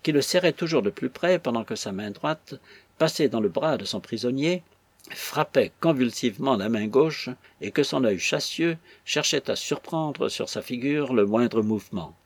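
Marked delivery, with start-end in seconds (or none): none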